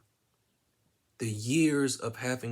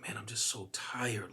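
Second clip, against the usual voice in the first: barely voiced, drifting off; weary whisper; 5 dB quieter than usual. To paramedics, whispered like fading to sleep.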